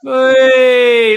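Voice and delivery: sustained fan's shout; exclaiming at the match. Real, not acted. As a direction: cry out as the shot flies, drawn out. Calling out at a shot in the match.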